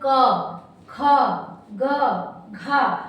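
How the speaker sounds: क, ख, ग, घ each said separately and slowly, with pauses, so the throat can be felt; teacher spelling out throat sounds; slow letter demo. Shown as a demonstration. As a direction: sound out क ख ग घ one by one to show they come from the throat. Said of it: "feeling आती है कि हम कहाँ से बोल रहे".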